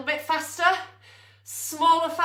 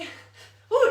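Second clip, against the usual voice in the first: strained ooh; 8 semitones higher, 7 dB louder than usual. Real, not acted. Strain mid punching drill.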